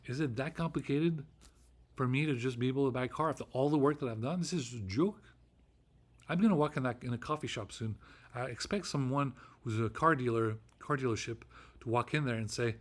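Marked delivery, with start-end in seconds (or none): none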